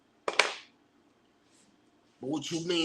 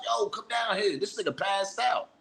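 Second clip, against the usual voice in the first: mock scream; 6 semitones higher, 4 dB quieter than usual. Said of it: "I'd have been screaming through the house".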